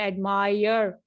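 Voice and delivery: "एडमायर" ending in a full "yer"; sounded R. Shown as a demonstration. Teacher explaining her word choice, "एडमायर" with sounded R. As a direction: sound the R clearly at the end of "एडमायर". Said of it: "बकायदा यर करके कहा".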